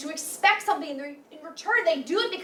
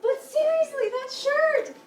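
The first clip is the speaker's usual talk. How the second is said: teasing exclamation; 10 semitones higher, 4 dB louder, slower than usual. Acted. Teasing boyfriend about shirt.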